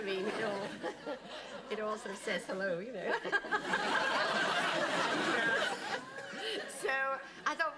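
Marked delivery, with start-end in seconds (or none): 3.2-6.8 long, loud, high laugh; helpless rolling laughter. Real, not acted. Laughing at the story.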